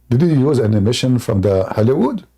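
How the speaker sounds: normal talking voice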